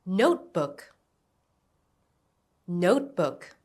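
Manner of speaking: "Notebook" with the first part, Note, stressed. Shown as a demonstration. Teacher modelling a word for learners to repeat, "Notebook" stressed on Note. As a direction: lean on "Note" and let "book" fall. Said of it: "Stress on note".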